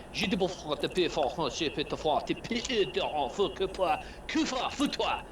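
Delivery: high, hammed-up fake French accent; mock French accent. The tech-priest cursing the players in fake French accent. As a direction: rattle off fake French accent gibberish as a snooty scholar. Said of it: "I had to do it in that accent".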